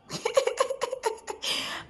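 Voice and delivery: high, soft run of giggles; real giddy laugh; bashful giggle. Real, not acted. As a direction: titter helplessly with embarrassment. Giggling after admitting she blushes.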